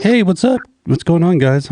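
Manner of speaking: high-pitched, casual phone greeting; breezy caller imitation. Cohost mimicking the friend who finally picked up, breezy and high. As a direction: mimic an oblivious, chirpy hello. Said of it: "he's like".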